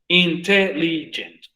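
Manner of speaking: intelligent said slowly and clearly; slow syllable count. Shown as a demonstration. Teacher slowly models intelligent to count syllables. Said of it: "¿Cuántas sílabas tiene la palabra intelligent?".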